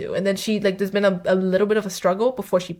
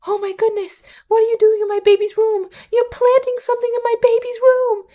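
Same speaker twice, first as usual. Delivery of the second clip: very high, loud put-on voice; hammed-up outraged mother squeal; mock alarmed koala mother; 6 dB louder than usual. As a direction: imitate a panicked mom catching an intruder. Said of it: "the koala is like".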